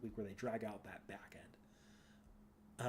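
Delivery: slow, breathy whispered aside to herself. Real, not acted. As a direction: drop to a whisper as if thinking aloud. Muttered aside about the boss.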